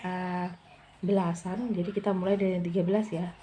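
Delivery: ordinary talk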